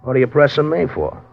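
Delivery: a cornered man lashing out; irritated outburst. Acted. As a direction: bristle at being pushed too hard. Suspect snaps at being questioned.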